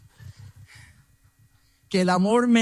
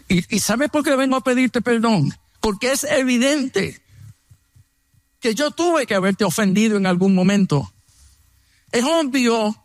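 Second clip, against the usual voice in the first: higher, intense apologetic retelling; pitched-up earnest apology; earnest plea; 6 semitones higher than usual. Retold or imitated. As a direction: plead your case sincerely. Heartfelt apology retold, rising intensity.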